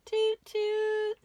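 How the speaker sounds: high, loud toot-toot like a train; train whistle toot. Acted. Host to children, a loud high train toot. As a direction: play the whistle for the kids.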